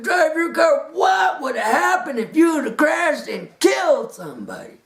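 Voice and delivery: high, loud, furious scolding; unglued judge. Retold or imitated. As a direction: rant at the defendant like an outraged judge. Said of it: "she come unglued on me".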